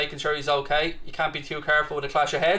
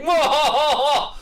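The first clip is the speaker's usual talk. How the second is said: high, slow villain cackle; evil cackle; 10 semitones higher, 4 dB louder, slower than usual. Pretend. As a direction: cackle like a panto baddie. Mock villain laugh at a viewer.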